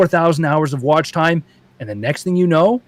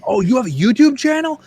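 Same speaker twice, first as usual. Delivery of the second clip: impressed fan; 9 semitones higher than usual. Retold or imitated.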